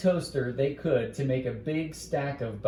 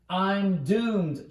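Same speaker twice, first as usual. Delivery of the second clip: dramatic exclamation; 6 semitones higher than usual. Acted. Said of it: "Charlie Brown exclaimed".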